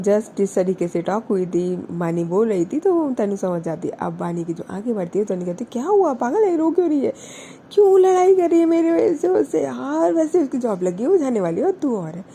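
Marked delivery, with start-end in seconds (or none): 5.7-12.2 alarmed. A narrator's reading. Tanu worried, asks Wani.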